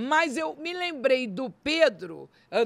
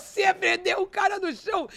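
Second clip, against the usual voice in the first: high, raised exclamation of amazement; joyful shouting; 8 semitones higher, 3 dB louder than usual. Retold or imitated. Amazed that God pinned the man down, high joyful shout. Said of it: "Eu queria gritar".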